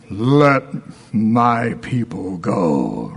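low, slow, booming movie-Moses line; Charlton Heston. Retold or imitated. Preacher imitating the film Moses commanding Pharaoh, low and slow. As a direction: drag it out like an epic film hero. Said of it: "because we all saw Charlton Heston".

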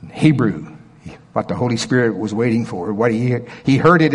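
ordinary delivery

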